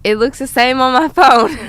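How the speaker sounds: amused comeback tipping into real laughter; laughing rebuttal. Real, not acted. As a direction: tease back through laughter. Teasing rebuttal breaking into laughter.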